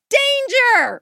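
high-pitched yell of alarm; acted shout to someone in danger; yelled warning. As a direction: yell it out like an urgent warning, far above your talking pitch. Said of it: "someone yells, like".

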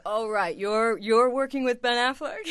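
a guard doubting her story, loud and mocking; mock incredulous guard. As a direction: scoff like you don't buy a word of it. Guard doubts her Ben Affleck claim.

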